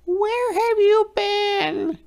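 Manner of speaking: very high, eager little fish voice; excited fish. A narrator's reading. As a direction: burst out eagerly. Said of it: "asked the fish excitedly".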